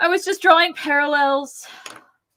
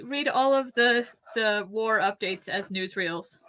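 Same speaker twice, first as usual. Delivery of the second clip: ordinary delivery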